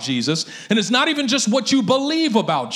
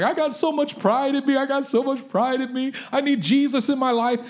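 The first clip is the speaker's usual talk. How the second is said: retold weeping; 5 semitones higher than usual. Bodybuilder at the altar confessing his pride, mild retold weeping. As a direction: voice the broken man confessing, lightly tearful. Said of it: "he was weeping and crying".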